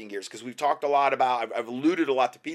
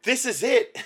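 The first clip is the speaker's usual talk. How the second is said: excited; 7 semitones higher, 3 dB louder than usual. Retold or imitated.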